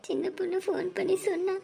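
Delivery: high, sing-song mourning; keen through the loss, voice held high; crying lament. Acted. Younger sister mourning an elder who left unseen, wailing. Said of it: "அழுகாதீங்கடி".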